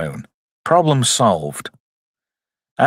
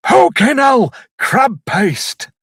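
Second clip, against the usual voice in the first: mock disgusted outburst; 10 semitones higher, much slower than usual. Hero reacting to a smelly finger, mock disgust. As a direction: exclaim it way up high, appalled.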